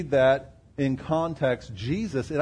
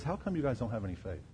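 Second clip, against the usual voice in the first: soft rebuke; 4 semitones lower, 10 dB quieter, much faster than usual. Retold or imitated. Jesus rebukes the disciples.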